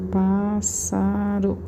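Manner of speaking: "Pássaro" very slowly, each syllable drawn out. Shown as a demonstration. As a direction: stretch "Pássaro" as if writing it down. Dita devagar enquanto escreve. ("Pássaro" bem devagar, sílaba por sílaba)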